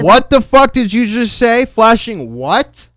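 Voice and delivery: loud shocked disbelief; shocked, slow demand. Real, not acted. Shocked at message.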